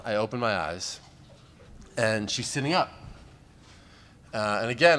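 normal talking voice

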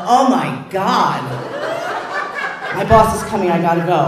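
loud, high, laughing mock panic; panicked husband. Husband hanging up in a panic, loud mock alarm. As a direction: blurt it out as if caught.

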